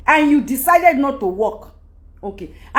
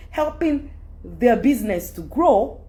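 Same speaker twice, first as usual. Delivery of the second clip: ordinary delivery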